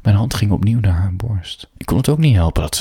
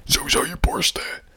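inner scream; 4 dB quieter, slower than usual. A narrator's reading. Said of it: "terwijl mijn hoofd schreeuwde".

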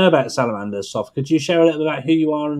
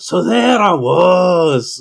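slow, drawn-out Scottish storyteller opening, louder and higher; Billy Connolly; 6 semitones higher, 6 dB louder, much slower than usual. Pretend. Host does a Billy Connolly opening line in slow Scottish. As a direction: stretch it out like a Glaswegian comic starting a yarn. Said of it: "sort of Billy Connolly starter there".

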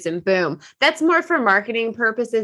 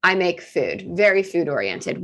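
ordinary talk, nothing marked

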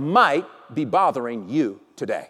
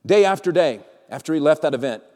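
ordinary talk, nothing marked

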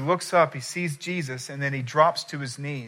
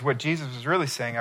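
ordinary delivery

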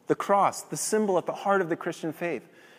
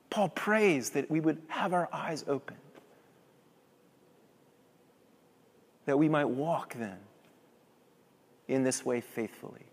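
hushed sermon close; 3 semitones lower, 5 dB quieter than usual. Acted. Soft closing words.

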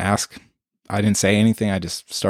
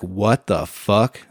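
slow bewildered; much slower than usual. Retold or imitated. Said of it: "And I was like".

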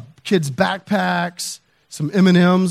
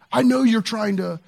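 retold accusation, pitched up; confrontational; 6 semitones higher than usual. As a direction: imitate a neighbor confronting you. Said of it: "from this confrontational".

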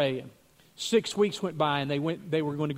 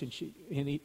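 normal talking voice

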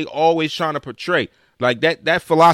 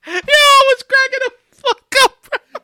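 laughing; 4 dB louder than usual. Real, not acted. Laughing about funeral scene.

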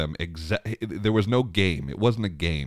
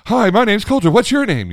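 high, loud imitation of an eager kid; talking over her; 10 dB louder than usual. Son cutting off the lifeguard's greeting, blurting loudly. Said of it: "he runs right over her talking again".

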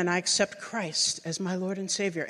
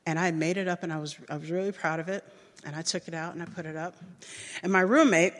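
usual speaking voice

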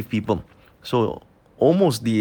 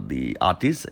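ordinary delivery

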